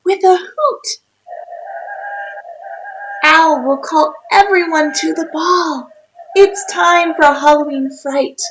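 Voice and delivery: put-on lively read-aloud for a child; storybook reading. A narrator's reading. Reads rhyming picture book aloud.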